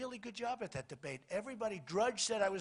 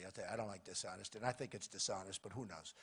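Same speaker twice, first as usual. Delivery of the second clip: muttered aside; 11 semitones lower, 8 dB quieter than usual. Real, not acted. Muttered aside about dishonesty.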